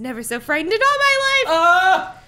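loud, high hammed-up yell; dramatic shout. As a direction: ham up the terror.

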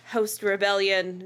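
usual speaking voice

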